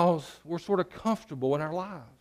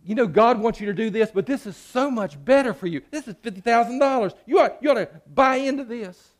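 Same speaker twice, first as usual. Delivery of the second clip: higher, coaxing tempter voice; Satan luring a churchgoer with easy money; smooth-talking Satan; 7 semitones higher, 4 dB louder than usual. Acted. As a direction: sell the lie sweetly, like a salesman. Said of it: "He is never gonna say".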